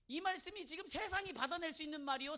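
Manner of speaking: ordinary delivery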